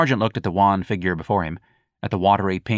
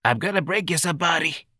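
wheezing; 9 semitones higher than usual. Acted. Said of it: "wheezed again, more unconvincingly".

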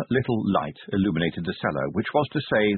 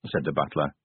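ordinary delivery

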